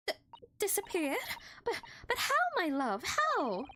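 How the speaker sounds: high, alarmed wife; dismayed wife. Acted. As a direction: panic over the lost coin. Wife shocked.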